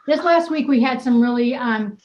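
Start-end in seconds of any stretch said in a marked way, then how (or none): none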